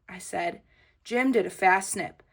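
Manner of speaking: usual speaking voice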